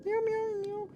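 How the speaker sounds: high squeaky cat meows; playful high meowing to a cat; mock meows. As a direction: meow up at the cat like a kitten.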